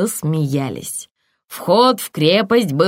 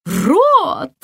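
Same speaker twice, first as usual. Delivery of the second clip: high, loud delighted cry; a germ thrilled by the idea; excited exclamation; 10 semitones higher, 4 dB louder than usual. A narrator's reading. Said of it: "воскликнула Элла". (радостное восклицание)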